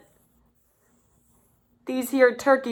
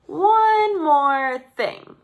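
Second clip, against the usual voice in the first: slow teasing; 6 semitones higher, 4 dB louder, much slower than usual. A narrator's reading. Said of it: "said Wing".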